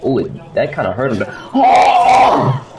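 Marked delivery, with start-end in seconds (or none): 1.5-2.7 loud high yelp; startled shout. Real, not acted. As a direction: yelp in shock. Hit by monster.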